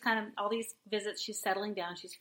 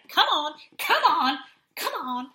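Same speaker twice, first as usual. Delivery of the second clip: quick urgent "come on"s, laughing; urgent coaxing; 7 semitones higher, 10 dB louder than usual. Retold or imitated. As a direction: hurry him out with a laugh. Urging the guy out, laughing.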